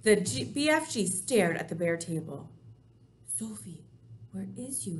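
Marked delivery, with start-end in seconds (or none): 3.3-5.0 hushed, desperate inner voice; a soft anxious murmur to himself; desperate thought. Acted. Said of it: "he thought desperately".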